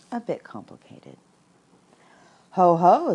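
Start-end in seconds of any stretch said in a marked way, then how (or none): 2.5-3.1 abrupt loud exclamation, pitched up; loud and sudden. A narrator's reading. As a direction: burst out suddenly with a big call. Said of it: "said Christopher Robin loudly and suddenly".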